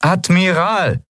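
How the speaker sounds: high, slow, insistent call; insistent, high-pitched summons. A narrator's reading. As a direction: demand the officer's attention, drawing the word out. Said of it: "diesmal fordernder".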